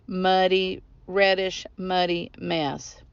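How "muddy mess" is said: plain reading voice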